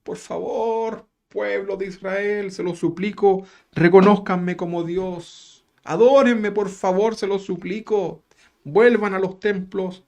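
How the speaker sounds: hammed-up imploring plea; mock pleading Jesus. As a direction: beg the people of Israel sarcastically. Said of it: "¿sabe cuál fue?".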